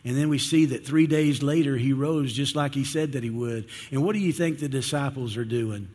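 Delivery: ordinary delivery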